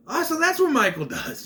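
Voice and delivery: squeaky high, amazed exclamation; high mock realization. Reviewer mocking the killer's sneaky habit, high and amazed. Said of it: "I'm like".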